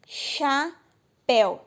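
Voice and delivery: "Chapéu" split slowly into cha and péu; very slow syllable split of "Chapéu"; two syllables. Shown as a demonstration. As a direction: say "Chapéu" as two long, separate beats. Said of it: "Tem duas sílabas".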